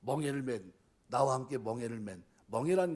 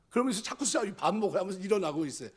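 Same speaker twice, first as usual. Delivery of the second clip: normal talking voice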